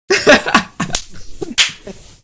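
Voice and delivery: cracking up in disbelief; high laugh. Real, not acted. Amazed the phobia vanished.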